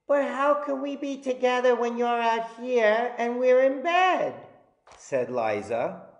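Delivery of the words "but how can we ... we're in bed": put-on higher, questioning little girl; a puzzled little girl asking Mom; child voice. A narrator's reading. Child voice in story.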